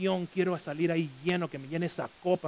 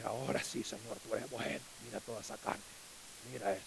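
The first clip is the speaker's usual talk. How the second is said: hushed, lower, softer gasp of delight; awed hushed prayer; 7 semitones lower, 11 dB quieter than usual. Retold or imitated. Preacher mimics a diner praying softly over his meat. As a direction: whisper thanks to God over a plate of meat.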